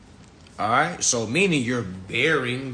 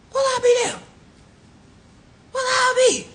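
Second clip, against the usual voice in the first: mock amazement; 5 dB louder than usual. Real, not acted. Mock amazement at the legal definition.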